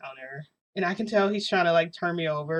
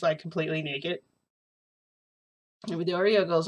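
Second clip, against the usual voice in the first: ordinary delivery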